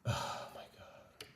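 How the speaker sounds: whispered, defeated oh my God; despairing whisper. Real, not acted. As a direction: breathe it out in quiet despair. Despair after losing prompt.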